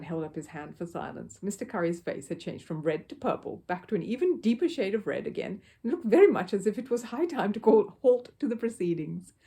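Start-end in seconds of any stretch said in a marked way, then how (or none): none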